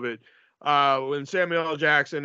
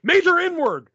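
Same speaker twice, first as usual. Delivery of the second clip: high, loud declaration; imitating Mannix standing up; mock announcement; 13 semitones higher, 6 dB louder, slower than usual. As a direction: announce it with relish. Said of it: "Mannix stands up and he's like".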